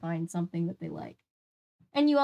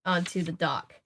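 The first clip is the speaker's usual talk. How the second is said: plain reading voice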